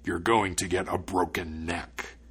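low, slow threat; frightening voice. A narrator's reading. Spirit threatening the student with death, frightening. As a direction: pronounce doom slowly in a low spirit voice. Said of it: "in a frightening voice".

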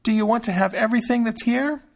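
high, carrying call; Thumbling shouting through the house; crying out. A narrator's reading. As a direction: call out with all his might. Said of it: "cried out with all his might".

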